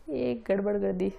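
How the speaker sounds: low, soft aside on noticing a slip; quiet self-correction. Real, not acted. Teacher catching their own mistake mid-lesson, low and quiet.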